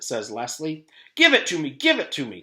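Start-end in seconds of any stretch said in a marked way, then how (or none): 1.1-2.4 louder, higher greedy repeat; demanding. A narrator's reading. Terrence repeating his demand to teacher Louis. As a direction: push the demand again. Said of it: "Terrence repeated".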